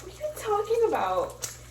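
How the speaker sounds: high-pitched, mock-baffled question; incredulous retort. Client baffled by her therapist's probing, incredulous. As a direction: retell it as an exaggerated, high-pitched protest.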